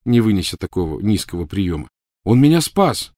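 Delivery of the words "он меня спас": firm. Acted. Harry defending Ron.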